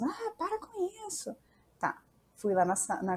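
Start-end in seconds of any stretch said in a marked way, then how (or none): 0.0-1.3 uncles scolding. Retold or imitated. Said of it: "E os tios".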